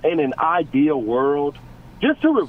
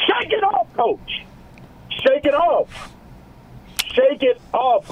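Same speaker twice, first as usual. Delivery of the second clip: high-pitched plea; 11 semitones higher, slower than usual. Real, not acted. Yelling at coach to change.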